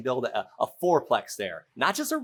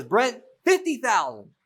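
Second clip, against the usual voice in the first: much higher, louder and slower seller voice; Lisa voiced; 9 semitones higher, 5 dB louder, slower than usual. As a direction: announce the price in a pitched-up voice.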